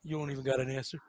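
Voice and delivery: very quiet, low mutter. Retold or imitated. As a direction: brush it off under your breath. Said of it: "He goes".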